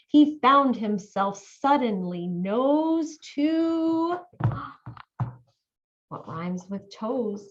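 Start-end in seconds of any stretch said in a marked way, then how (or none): 4.2-5.3 gasp. Acted. Mouse meets lion.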